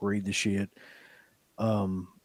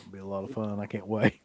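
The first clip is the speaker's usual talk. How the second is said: sarcastic; 5 semitones lower, 10 dB quieter than usual. Real, not acted. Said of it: "Can't keep a straight face on that".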